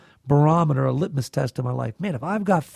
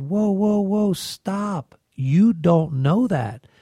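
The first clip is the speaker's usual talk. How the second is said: raised, drawn-out objection, acted from memory; retold firm interruption; 5 semitones higher, much slower than usual. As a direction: halt someone, pitched up then slowing down. Retells interrupting a worried man.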